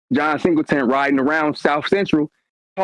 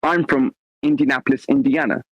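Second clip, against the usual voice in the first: British English accent, each word neatly placed. Shown as a demonstration. Showing his English accent against his hometown voice, English accent. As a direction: introduce yourself in a put-on English accent. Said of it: "I can do an English accent fairly decent".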